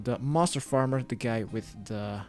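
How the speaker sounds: ordinary delivery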